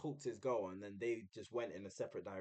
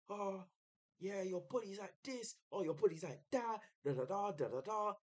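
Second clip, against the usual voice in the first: retold shouting; 8 semitones higher than usual. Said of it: "he was shouting this at her".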